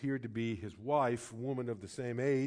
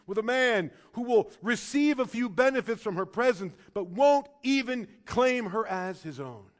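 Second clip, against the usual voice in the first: high, loud, impassioned pulpit voice; fiery sermon delivery; preaching at full force; 10 semitones higher, 8 dB louder than usual. Acted. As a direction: drive the point home to the congregation.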